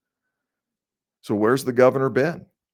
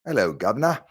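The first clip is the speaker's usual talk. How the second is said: a light British "Hello, Governor"; British accent. Shown as a demonstration. Podcast host trying a British greeting. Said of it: "the British way".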